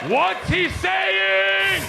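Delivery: high, very drawn-out yell; insistent shout. Acted. Traveler still demanding a translation, long high shout. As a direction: shout it slowly, stretching the end. Shouts the same question once more.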